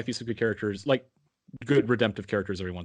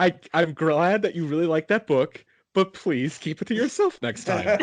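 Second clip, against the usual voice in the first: retold exasperated mom; 7 semitones higher, 3 dB louder than usual. Mother's exasperated reply, retold.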